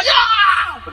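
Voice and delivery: high, drawn-out yell; comically keen volunteer cry; eager shout. Retold or imitated. Worker grabs overtime first, eager mock shout. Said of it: "el primero que gritaba".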